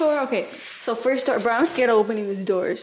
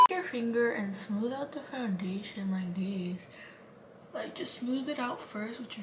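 whispering; 2 semitones lower, 10 dB quieter than usual. Real, not acted. Makeup tip whispered.

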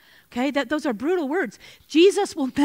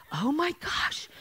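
soft, mortified "oh my gosh"; a quiet, embarrassed retold gasp; hushed mortified gasp; 3 semitones higher, 6 dB quieter, slower than usual. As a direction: let it slip out under your breath in dismay. Caught by a stranger at her worst.

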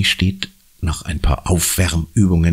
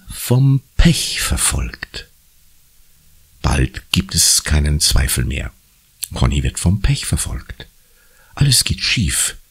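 ordinary delivery

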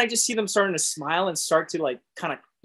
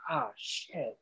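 soft sinking aside; hushed dread; 2 semitones lower, 12 dB quieter than usual. Retold or imitated. Told the manager needs to see you, hushed dread. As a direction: drop the volume as dread sets in.

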